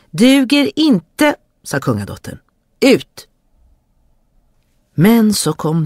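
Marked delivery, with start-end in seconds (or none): 0.1-3.2 curt command. Acted. Princess rejecting the second brother, curt. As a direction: dismiss him, then bark him out.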